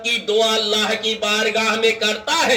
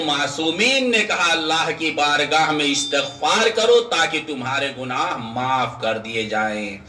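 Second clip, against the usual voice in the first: ordinary talk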